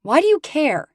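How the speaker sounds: high, heated retort; angry. Acted. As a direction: fire the question back. Shouting back in argument.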